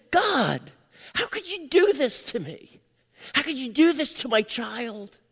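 high, anguished plea; tearful reproach. Retold or imitated. Father weeping at his desk to God, retold tearful reproach. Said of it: "And I wept".

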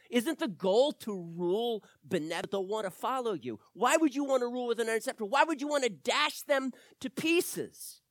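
fast, high, loud questions; rapid rhetorical preaching. Acted. Preacher challenges the congregation with fast, loud questions.

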